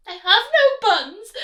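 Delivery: very high, loud squirrel voice; scared squirrel. Acted. Squirrel squeaking to the rat, high and scared. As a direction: squeak it, terrified.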